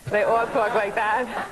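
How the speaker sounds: slow, thick Long Island accent; playful imitation of Long Island speech. Shown as a demonstration. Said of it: "All my cousins talk like that".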